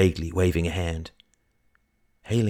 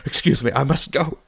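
higher, urgent parting words; flustered excuse; 8 semitones higher, 5 dB louder than usual. Acted. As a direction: excuse yourself in a hurry. Hurried exit from the shop.

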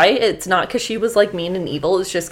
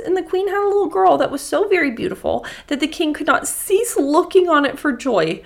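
higher, lively storybook reading; storybook narration; 10 semitones higher than usual. Acted. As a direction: read it like a children's tale. Fairy tale narration, raised pitch.

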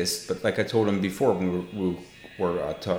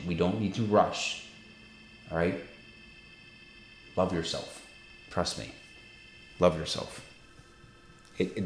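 tender reassurance; 2 semitones lower, 3 dB quieter than usual. Acted. Pastor gently consoling a guilty listener, softly. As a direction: soothe him gently, like a pastor.

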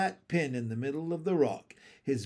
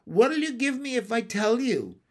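high, wheedling frog voice; crafty, raised-pitch frog; sly bullfrog; 7 semitones higher, 4 dB louder than usual. A narrator's reading. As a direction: tease out a bargain. Bullfrog bargains slyly.

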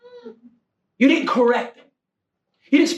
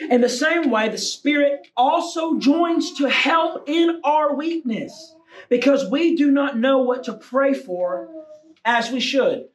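high, measured, emphatic reading; forceful scripture reading; 6 semitones higher, slower than usual. Acted. Preacher reading scripture to the congregation, high and emphatic. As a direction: proclaim each phrase, drawing out the key words.

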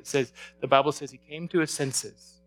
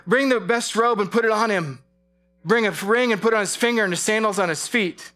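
rising, louder and higher as the father runs; preaching at full force; 5 semitones higher, 5 dB louder than usual. Acted. Preacher retelling the prodigal son's return, building to full force. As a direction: let the joy build, pushing the pitch up.

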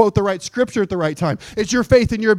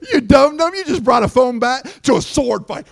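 mock the devil with raised pitch; taunting believer; 5 semitones higher than usual. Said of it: "you go".

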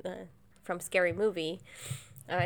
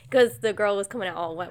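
ordinary talk, nothing marked

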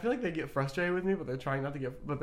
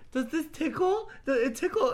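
teasing hairdresser; 12 semitones higher, 4 dB louder than usual. Retold or imitated. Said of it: "she'd just be like".

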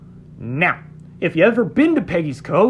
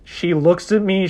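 ordinary talk

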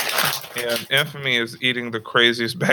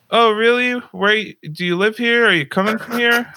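put-on high bubbly girl voice; 10 semitones higher, 3 dB louder than usual.

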